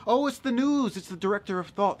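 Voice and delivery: high, loud hammed-up exclamation; mock excitement. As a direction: exclaim it as if thrilled by the TV. Acts out reaction to the TV.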